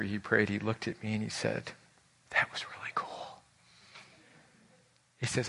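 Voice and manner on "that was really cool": awed whisper; a whispered wonder. Retold or imitated. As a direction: say it under your breath, moved. Man moved after praying.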